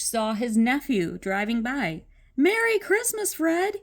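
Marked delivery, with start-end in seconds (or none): 2.4-3.8 much higher, cheerful call. Acted. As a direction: hail him across the street. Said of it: "he called".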